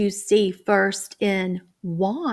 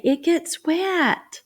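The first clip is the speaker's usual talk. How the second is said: put-on higher, slow childlike reply; imitating child answer; 8 semitones higher, slower than usual. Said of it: "I hope they say".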